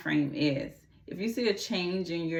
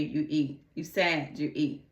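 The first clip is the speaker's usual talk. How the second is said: ordinary talk, nothing marked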